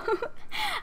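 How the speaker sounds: high giggling burst, then fading; burst of laughter. Real, not acted. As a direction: let the held-in laugh break out. Laughing fit while recording.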